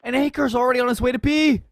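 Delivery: fast, loud play-by-play; esports caster. Acted. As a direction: ride the hype of the round.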